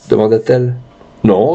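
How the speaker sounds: usual speaking voice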